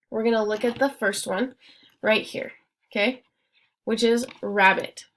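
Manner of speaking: ordinary talk, nothing marked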